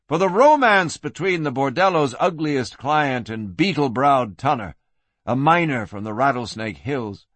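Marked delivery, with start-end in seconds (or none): none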